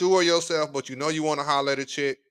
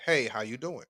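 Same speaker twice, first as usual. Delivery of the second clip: soft, lower smooth hello; smooth greeting demo; 4 semitones lower, 6 dB quieter than usual. Shown as a demonstration. Host demonstrates the greeting to guys, soft and smooth. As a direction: show him how easy the greeting is. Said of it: "simple way to approach a woman, say".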